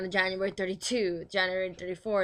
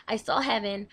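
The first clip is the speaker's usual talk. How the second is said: ordinary talk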